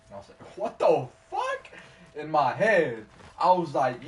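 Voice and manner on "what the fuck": high, loud, drawn-out shocked cry; shocked disbelief. Real, not acted. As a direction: blurt it out in total disbelief, much higher and louder. Shocked at stranger's offer.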